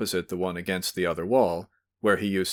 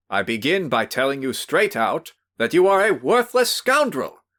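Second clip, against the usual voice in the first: louder, higher angry accusation; angry cry; 6 semitones higher, 6 dB louder than usual. Acted. Host confronts the drunk visitor, angry cry. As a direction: fling the insult at him. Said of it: "cried Velchaninov".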